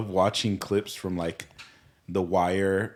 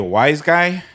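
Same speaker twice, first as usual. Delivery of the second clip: mock wiseguy; 7 semitones higher, 7 dB louder, slower than usual. Mobster banter joke.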